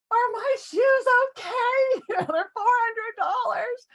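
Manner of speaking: hammed-up selfish shoe panic; frantic about shoes. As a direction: squeal about your precious shoes. Dazed after fall, worried shoes.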